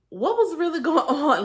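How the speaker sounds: high, loud, laughing through the question; half-laughing as she asks herself what was going on; amused disbelief. Retold or imitated. Disbelief at her old breathlessness.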